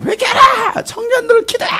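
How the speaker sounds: exaggerated high-pitched exhortation; preacher impression shout. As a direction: shout it as a parody of fiery preaching. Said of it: "그렇게 저를 흉내내는 거죠".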